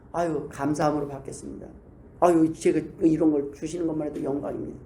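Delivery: humble gratitude. Retold or imitated. Humble grateful prayer.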